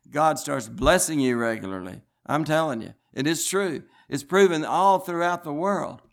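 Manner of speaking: ordinary talk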